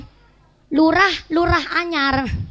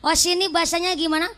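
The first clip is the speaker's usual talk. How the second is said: normal talking voice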